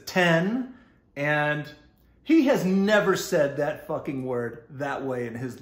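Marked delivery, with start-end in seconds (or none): 0.1-0.7 "Ten" said with a clear short E, higher and louder; Mark's clean "Ten". Retold or imitated. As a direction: say "Ten" with a crisp E, not Texas "tin". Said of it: "he says".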